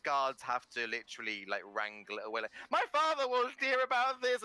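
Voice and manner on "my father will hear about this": high, loud spoiled outrage; indignant threat. Acted. Spoiled contestant hauled off stage, high indignant yell. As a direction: shriek it as they drag you off. Ilorin dragged off by guards.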